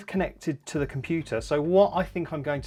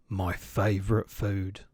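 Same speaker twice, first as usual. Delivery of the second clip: hungry Gruffalo; 8 semitones lower, slower than usual. Acted.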